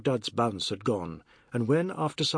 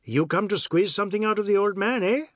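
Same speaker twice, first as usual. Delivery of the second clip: breezy; 7 semitones higher, 4 dB louder than usual. Acted. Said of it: "Jagdat said breezily".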